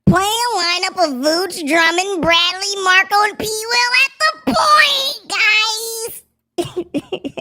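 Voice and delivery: high, squeaky, slow mock fan. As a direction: mimic a whiny high-pitched fan pitching a lineup. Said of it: "Bulls fans out here with the squeaky voice like".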